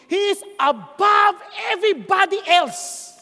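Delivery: slow, loud proclaiming; preaching shout. Real, not acted. Preacher declares God above all, slow loud shout. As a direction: lift it up as a preacher's cry.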